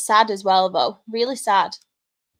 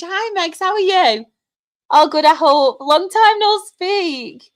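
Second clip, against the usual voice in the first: excited greeting; 7 semitones higher, 5 dB louder than usual. Real, not acted.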